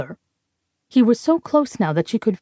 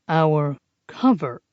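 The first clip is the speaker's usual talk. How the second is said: slow and patient, spelling it out; slowly, as to a small child; 4 semitones lower, slower than usual. Acted. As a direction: drag each word as if explaining to a child. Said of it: "he repeated slowly, as if talking to a small child".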